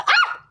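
sudden high, loud cry; real sharp squeal at a burn; yelp of pain. Real, not acted. Vlogger burns their hand while styling, high pained yelp. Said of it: "Elim yandı".

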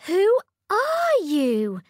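slow, high, loud amazement; wide-eyed princess; astonished princess. A narrator's reading. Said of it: "said the princess".

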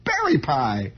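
high, singsong mock waitress; mimicking the waitress. Pie counter woman repeating herself, high singsong. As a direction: parrot it back high and flat-out insistent. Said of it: "She says".

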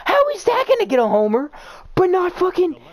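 high, loud, laughing outrage; outraged laughing rant. Real, not acted. Outraged at homer call.

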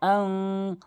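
"আং" sustained as a nasal hum; ghunnah. Shown as a demonstration. Teacher repeats the rule for learners, long nasal "আং". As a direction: stretch "আং" evenly through the nose. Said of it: "গুণনা করতে হবে".

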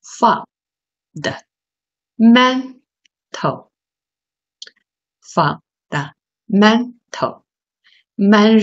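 "fund，mental，fundamental，man" spelled out slowly, "man" stressed; syllable by syllable. Shown as a demonstration. Teacher splitting the word for students, slow syllable drill. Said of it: "你可以把它划成四个部分".

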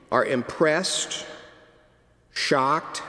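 ordinary delivery

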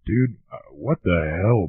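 normal talking voice

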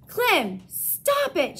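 loud, high, firm command; firm shout. A narrator's reading. Girl shouts firmly at her dog to stop. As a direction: stop the dog with a sharp order. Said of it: "she shouted".

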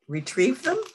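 ordinary delivery, nothing marked

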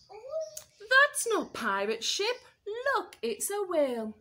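high, loud, slow alarmed cry. Acted. George spotting the whale, crying out in alarm. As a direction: shout the warning in fright. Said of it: "gave a cry".

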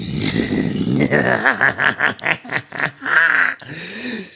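long maniacal cackle; evil cackle. Acted. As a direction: cackle in triumph. Goblin villain laughing.

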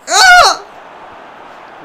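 high, loud frustrated yelp. Real, not acted. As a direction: burst out in real frustration. Frustrated cry at game.

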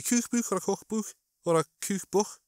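high Scouse "cookbook" alternating long and short oo; vary "cookbook" between moon and foot vowels; mixed Scouse vowels. Shown as a demonstration. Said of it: "sometimes you get people who do both".

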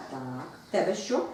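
normal talking voice

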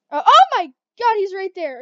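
sudden startled yelp; panicked, high and loud; panicking gamer. Real, not acted. Player suddenly attacked.

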